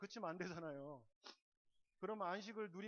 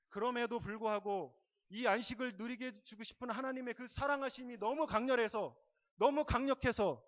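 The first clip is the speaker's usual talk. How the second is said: loud, raised sermon voice, driving each phrase; preaching at full force; 6 semitones higher, 11 dB louder than usual. Real, not acted. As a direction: proclaim it to the congregation with real force. (힘주어 높이 외치는 설교)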